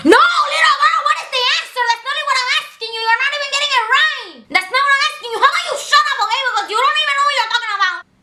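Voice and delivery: very high, rapid-fire mock tirade; scolding teacher. Acts teacher scolding student.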